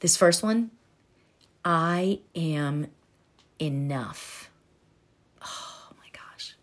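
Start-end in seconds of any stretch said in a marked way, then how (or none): 1.6-4.5 soft affirmation. Shown as a demonstration. Slow soft affirmation demo. 5.4-6.5 breathless, barely voiced awe; awed whisper. Real, not acted. Coach moved by the affirmation, whispering in awe.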